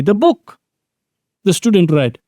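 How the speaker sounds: "book" pitched high, then a pause before "the student read"; stress on "book". Shown as a demonstration. Teacher telling somebody the surprising news, stress on "book". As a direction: lift "The book" up high and set it apart. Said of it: "I want to emphasize that".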